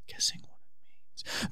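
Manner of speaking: fading mock echo, whispered. Host echoing his game rules, fading whisper. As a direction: whisper it away like a dying echo.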